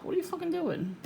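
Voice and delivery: high, indignant mock yell at the driver; incredulous retold outburst; mock outrage. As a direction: fling the question at him in disbelief. Ranting at driver who sped off.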